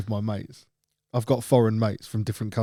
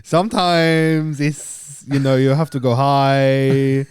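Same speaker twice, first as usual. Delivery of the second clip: hammed-up, drawn-out Thai accent; slow sing-song fake Thai accent; mock Thai accent; 2 semitones higher, 4 dB louder, slower than usual. Shown as a demonstration. Asked for his Thailand voice, mock Thai accent. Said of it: "when you were going to Thailand".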